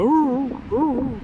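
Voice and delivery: high hound howl, drawn out; dog howl imitation. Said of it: "He's going".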